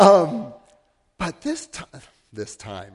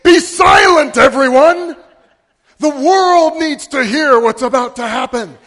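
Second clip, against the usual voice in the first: loud, high, commanding God voice; booming command; 8 semitones higher, 15 dB louder than usual. Retold or imitated. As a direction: boom it out like God silencing the crowd. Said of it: "God stood up off his throne and said".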